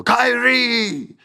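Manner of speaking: high, loud mock summons; calling Kairi. Asuka summoning Kairi, hammed-up high loud call. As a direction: yell her name up high like a bossy leader. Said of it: "Asuka every week will go".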